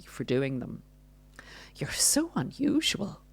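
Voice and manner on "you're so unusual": much higher, softer, sugary fake compliment; mock sweet narcissist. Narcissist disguising an insult, high sugary mock praise. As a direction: coo it like a backhanded compliment. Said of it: "criticism wrapped up in wrapping paper".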